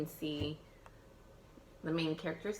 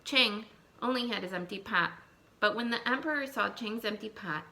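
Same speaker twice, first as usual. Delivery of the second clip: put-on lively read-aloud for young children; storybook reading; 3 semitones higher, 4 dB louder than usual. Teacher reading a folk tale to her class, lively storytelling.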